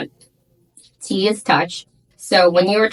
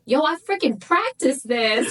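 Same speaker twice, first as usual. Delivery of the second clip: proud practiced voice; 9 semitones higher than usual. Shown as a demonstration. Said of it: "I used to talk like this".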